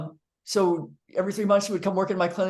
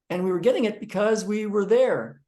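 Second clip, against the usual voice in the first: ordinary talk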